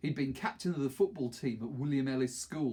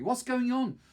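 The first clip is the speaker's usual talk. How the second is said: Maxie demanding answers, higher and louder; angry girl shout; 11 semitones higher, 5 dB louder than usual. A narrator's reading. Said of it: "said Maxie angrily".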